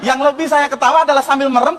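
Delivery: normal talking voice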